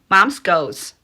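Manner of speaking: usual speaking voice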